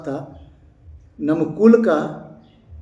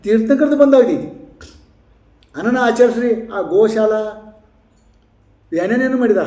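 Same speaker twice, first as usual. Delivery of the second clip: impassioned sermon climax; fervent preaching; 5 semitones higher than usual. Acted. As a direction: rouse the congregation to practise. Preacher exhorts the audience.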